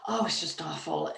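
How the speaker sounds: usual speaking voice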